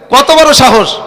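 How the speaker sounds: normal talking voice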